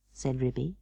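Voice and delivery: ordinary delivery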